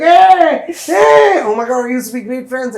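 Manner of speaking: very high, loud delighted hey hey; excited reunion. Acted. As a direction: squeal it like spotting an old friend. Bumping into old friend later.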